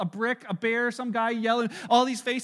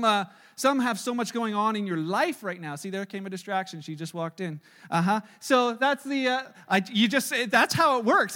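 normal talking voice

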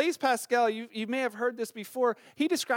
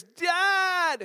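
put-on higher child's call of Dad; 8 semitones higher than usual. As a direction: yell Dad like a kid in bed. Kid calling out third time.

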